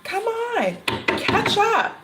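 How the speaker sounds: a friend urging a slowpoke in a high mock voice; impatient coaxing. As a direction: hurry him along, fed up. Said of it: "it's like".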